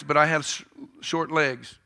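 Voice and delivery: normal talking voice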